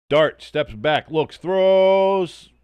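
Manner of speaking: high, loud slowed call of the throw; rising live play-call; excited play-by-play. Real, not acted. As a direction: build the call to the pass.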